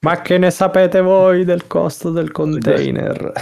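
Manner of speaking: anonymous insider with altered voice. Pretend. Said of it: "che con la voce alterata".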